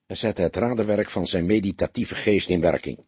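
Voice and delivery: ordinary delivery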